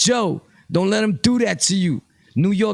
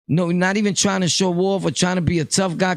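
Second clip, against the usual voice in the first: ordinary delivery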